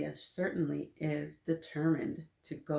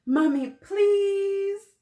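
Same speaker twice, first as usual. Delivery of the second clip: very high, loud, drawn-out plea; child begging mom in a whiny loud plea; 10 dB louder, much slower than usual. Acted. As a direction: wail the please, much higher and louder. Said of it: "I beg again in a louder tone".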